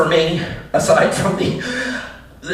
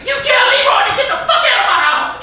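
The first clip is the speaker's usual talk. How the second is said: screaming profanity. Retold or imitated. Guy on a headset yelling into it on the train. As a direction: yell it at the phone like you've lost it. City version of the yell.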